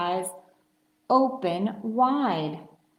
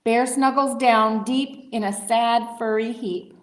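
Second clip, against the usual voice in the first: plain reading voice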